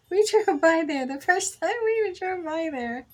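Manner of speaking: getting worked up telling the store name; excited retelling. Retold or imitated.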